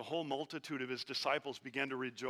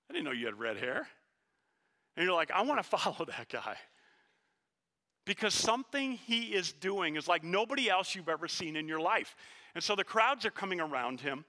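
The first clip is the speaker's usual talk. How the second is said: louder, higher, driving preacher voice; preaching at full force; 5 semitones higher, 3 dB louder than usual. Acted. Preacher stirring the congregation, full-force preaching. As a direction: preach it out to the back row.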